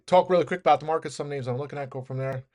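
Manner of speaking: quick rushed delivery; talking fast. Real, not acted. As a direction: rattle it off at speed. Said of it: "I'm gonna talk really fast".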